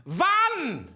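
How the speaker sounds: loud, high German "Wann! Wann!"; German count-off. Shown as a demonstration. Drummer counting in a disco band, loud German accent. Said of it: "And it's very important that you".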